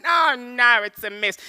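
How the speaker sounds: acted rattled driver; panicked dismay. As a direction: cry out as it all goes wrong. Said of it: "I'm panicking".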